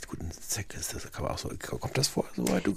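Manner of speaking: unvoiced whisper on a taboo topic. Real, not acted. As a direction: drop to a whisper, as if nobody should hear. Asks about sex, lowered voice. (flüstern, verschämt)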